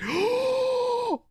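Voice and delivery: sharp, very high cry of disbelief; high-pitched gasp. Real, not acted. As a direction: let out a high, exasperated gasp. Streamer despairs over broken audio.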